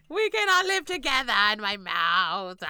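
loud mock-outraged popcorn voice. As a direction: play the offended popcorn loudly.

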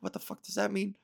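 under-the-breath what the fuck; inner thought. Retold or imitated. Bandmates talking lingo, inner soft confusion. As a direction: think it silently, barely voiced. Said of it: "In my head, I was like".

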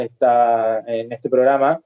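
ordinary talk

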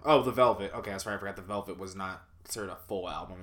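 normal talking voice

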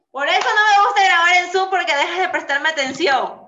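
loud, high-pitched scolding; exasperated complaint. Real, not acted. Scolding her friend for not paying attention on Zoom, loud exasperation. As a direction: cry out in playful exasperation.

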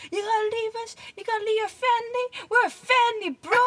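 put-on high, childlike mad voice; piping up like an annoyed five-year-old; mimicking little sister. Older brother imitating his five-year-old sister's mad voice at Mario Kart. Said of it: "she was getting mad".

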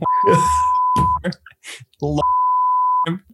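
very high, long squealing laugh. Real, not acted. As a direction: shriek of real laughter. Keeps laughing.

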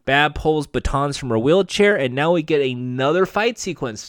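ordinary delivery

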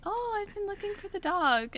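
embarrassed high excuse to the dad; sheepish excuse. Retold or imitated. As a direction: play it off sweetly. Retold sheepish excuse, high pitch.

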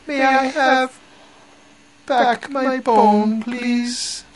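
very high, slow, polite little voice; small voice. A narrator's reading. Small voice asks the woman again, high and drawn out. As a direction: ask politely in a tiny, stretched-out high voice. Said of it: "she heard the voice again".

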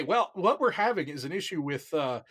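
normal talking voice

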